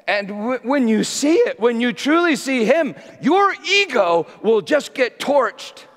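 preached at full force, high and loud; fiery preaching. Acted. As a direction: thunder it out to the congregation.

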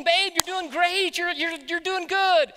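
high, slow cheering encouragement; encouraging wife. Retold or imitated. Wife encouraging husband on the surfboard, slow and high. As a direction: coax him on sweetly. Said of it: "She's going".

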